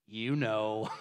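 low, quiet, knowing parrot; sly parrot. Acted. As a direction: drop it low, insinuating. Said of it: "The parrot looks at her and says".

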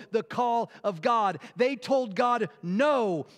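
loud, driving sermon climax; preaching at full force. Acted. Preacher urging the church, rising to a loud climax. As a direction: press it home to the congregation.

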